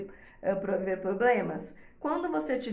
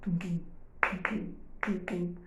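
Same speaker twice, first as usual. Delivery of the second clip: heartbeat imitation; 4 semitones lower, slower than usual. Shown as a demonstration. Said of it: "Ela escutava batimento cardíaco".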